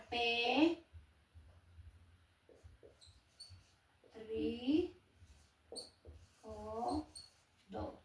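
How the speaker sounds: "pe-rí-o-do" broken into separate syllables, slow and soft with pauses; syllables split apart. Shown as a demonstration. Teacher splitting período into syllables for students, slow and soft. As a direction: spell out "P, tri, o, do" one syllable at a time, quietly.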